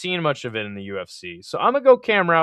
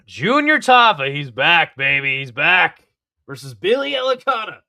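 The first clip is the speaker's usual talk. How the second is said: hype announcer; 3 semitones higher, 7 dB louder than usual. Real, not acted. Hyping a fighter's return.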